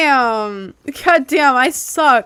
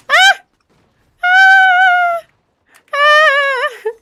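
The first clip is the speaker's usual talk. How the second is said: high startled screams; 7 dB louder, much slower than usual. Real, not acted. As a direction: shriek in sudden fright. Startled in game.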